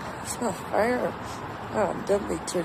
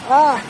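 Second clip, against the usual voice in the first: loud choked gasp; pained gasp; 3 semitones higher, 10 dB louder than usual. Real, not acted. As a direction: gasp between coughs. Coughing from tear gas.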